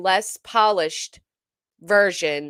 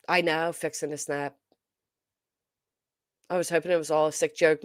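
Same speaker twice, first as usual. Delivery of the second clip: normal talking voice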